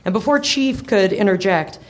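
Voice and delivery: ordinary talk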